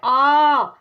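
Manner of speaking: आ held long and loud; teacher stretching आ. Shown as a demonstration. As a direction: stretch आ for the children. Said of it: "ये थोड़ा लंबा खींच रहा है".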